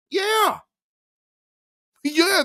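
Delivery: a sudden high shouted yeah; excited yeah. Real, not acted. Cohost agreeing with a sudden high shout.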